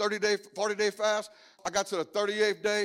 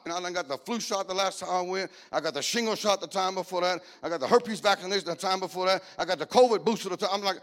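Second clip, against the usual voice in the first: ordinary talk, nothing marked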